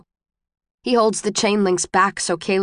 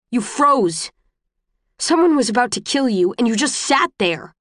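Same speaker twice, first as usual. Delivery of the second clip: angry, raised accusing voice; yelling; 4 semitones higher than usual. A narrator's reading. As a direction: yell at her for freezing. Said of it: "He is yelling now".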